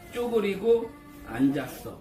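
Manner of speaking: slow, careful dictation; dictating. Shown as a demonstration. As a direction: spell it out slowly for writing.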